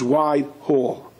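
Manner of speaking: ordinary delivery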